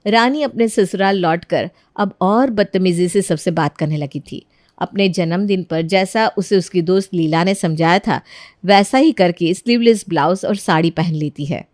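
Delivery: story narration. A narrator's reading. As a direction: narrate it evenly, like telling a tale.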